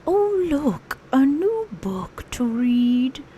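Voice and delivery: humming bear voice, slow and pleased; a toy bear finding a book; Pooh voice. Acted. Host voicing Pooh Bear, humming as he finds a book.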